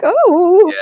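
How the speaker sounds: high, loud fussy-robot exclamation; C-3PO. Acted. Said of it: "like C three P O".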